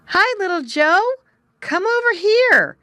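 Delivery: high, loud call across the water; young muskrat calling across the pool; calling shout. Acted. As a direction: shout over to his friend. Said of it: "shouted Jerry".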